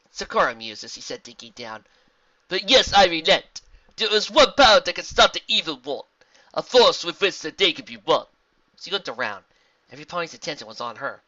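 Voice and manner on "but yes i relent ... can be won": loud, higher, measured zebra voice; solemn zebra. Acted. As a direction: reveal it with stately weight. Zebra reveals secret power.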